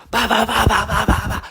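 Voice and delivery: rapid high "ba-ba-ba" impact noises; vocal sound effect of repeated blows; imitated punch flurry.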